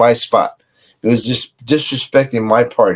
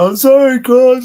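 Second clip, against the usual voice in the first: pitched up and louder, whiny tearful plea; imitating crying apology; 10 semitones higher, 4 dB louder than usual. Crying guy begging his rival for forgiveness, whiny and high. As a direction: mimic a guy blubbering sorry. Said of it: "He started crying".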